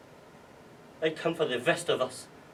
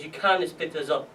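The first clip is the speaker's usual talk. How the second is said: building to a louder, higher plea; acted desperate pleading, rising; 5 semitones higher, 5 dB louder than usual. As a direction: beg them, more urgent with each phrase. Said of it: "I started to plead".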